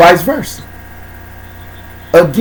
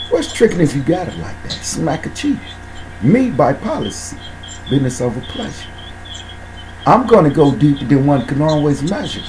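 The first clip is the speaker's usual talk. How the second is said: low spoken-word bars; 4 semitones lower, 8 dB quieter than usual. Real, not acted. Low rhymed spoken-word recital.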